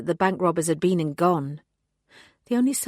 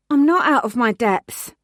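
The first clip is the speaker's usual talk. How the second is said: defiant lie; 5 semitones higher, 7 dB louder than usual. A narrator's reading. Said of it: "I lied".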